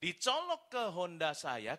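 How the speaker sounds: normal talking voice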